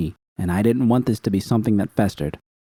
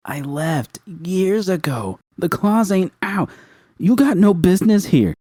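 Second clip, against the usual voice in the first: high, slow building to loud; defiant anger; 11 semitones higher, 3 dB louder than usual. A narrator's reading. Judy defying the gang boss, building to an angry shout. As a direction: spit it back, rising.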